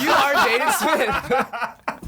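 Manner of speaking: laughing slowly up high; teasing through laughter. Real, not acted. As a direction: tease him while cracking up.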